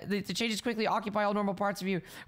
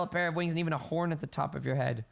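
ordinary talk, nothing marked

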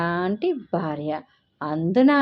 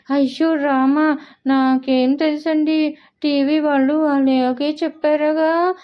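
whiny tearful; 7 semitones higher, 4 dB louder than usual. A narrator's reading. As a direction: whine like she's about to cry. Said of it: "ఏడుపు ముఖం పెట్టి".

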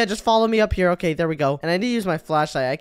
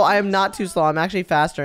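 ordinary talk

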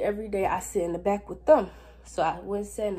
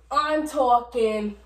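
slow demo; 4 semitones higher, 7 dB louder, much slower than usual. Shown as a demonstration. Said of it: "How is that yelling in your ear?".

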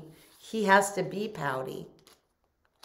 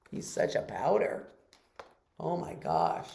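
usual speaking voice

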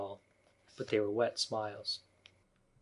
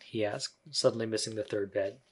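ordinary delivery

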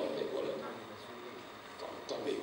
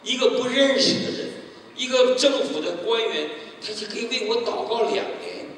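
moved emphasis; 10 semitones higher, 7 dB louder than usual. Real, not acted. Believer telling the church about the stranger's prayers, moved and loud. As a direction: press each phrase with wonder.

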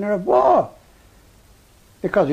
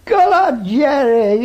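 high, loud call across no man's land; shouting across; 11 semitones higher, 5 dB louder, slower than usual. Retold or imitated. British soldier shouting praise to singing German, loud. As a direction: cheer him on at full shout. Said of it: "we're shouting".

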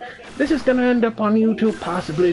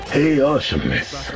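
put-on voice; 2 semitones lower than usual. Rereads viewer message in put-on voice.

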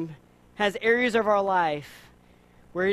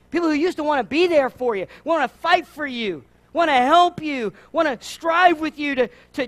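full-force preaching; 6 semitones higher, 7 dB louder than usual. Real, not acted. Preacher pleading with the congregation about bitterness, louder and higher. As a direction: drive each "so" home to the room.

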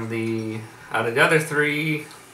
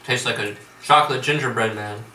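speaking while chewing. Real, not acted. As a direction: mouth partly full. Seen 0: Woman bit chocolate just before; hand at mouth, seems chewing while speaking.

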